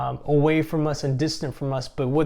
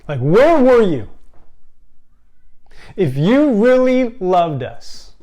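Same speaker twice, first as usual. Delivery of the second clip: a grieving sister accusing Jesus, loud and raw; anguished accusation; 5 semitones higher, 9 dB louder than usual. Acted. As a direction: hurl the question at him through tears. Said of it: "And I'm not even doing it justice".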